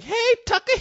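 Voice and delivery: very high falsetto woman calling out; high hallucinated-aunt voice; woman's voice, imitated. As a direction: mimic a woman's high-pitched holler.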